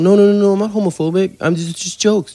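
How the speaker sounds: high, loud flustered protest; panicked backpedalling. Retold or imitated. Comic defending his joke to offended audience, mock panic. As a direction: stammer out a hammed-up, high-pitched defence.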